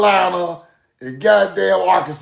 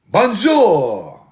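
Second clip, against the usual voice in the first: mock grandma French. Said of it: "The fuck wrong with grandma".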